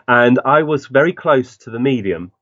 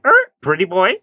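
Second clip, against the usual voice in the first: high squawky parrot imitation, much higher; 9 semitones higher than usual. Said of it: "And you heard".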